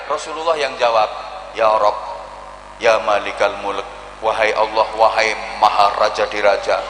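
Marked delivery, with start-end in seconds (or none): 1.6-6.8 low, reverent plea; solemn intercession. Acted. The Prophet vouching for him, lightly low and solemn. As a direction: answer calmly in reverence.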